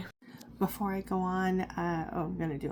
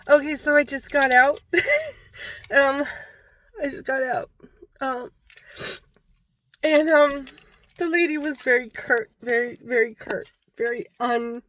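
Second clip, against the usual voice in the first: loud, high and rattled right after the appointment; high, loud, nervous rush; shaken up; 7 semitones higher, 9 dB louder than usual. Real, not acted. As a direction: blurt it out, still shaking. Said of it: "I'm fucking shaking".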